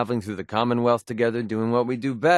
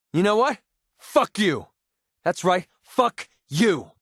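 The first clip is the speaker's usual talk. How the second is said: angry outburst; 8 semitones higher, slower than usual. Acted. Companion turns on the player, furious shout. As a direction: spit it at him in fury.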